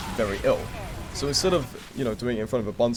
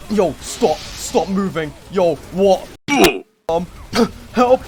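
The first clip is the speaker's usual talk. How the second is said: higher, louder panicked commands; the teacher shouting at a student running with a tub; teacher yelling; 8 semitones higher, 9 dB louder, much slower than usual. Retold or imitated. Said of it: "my teacher was like".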